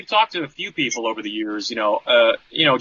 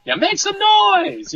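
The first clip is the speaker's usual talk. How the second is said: much higher, slow, stretched crowd call; rock frontman shout; 4 dB louder, much slower than usual. Pretend. Singer hyping the concert crowd, stretched rock shout. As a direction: hype the arena like a metal singer.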